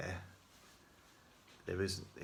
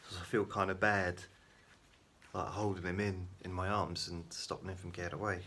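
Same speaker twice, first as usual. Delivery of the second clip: ordinary delivery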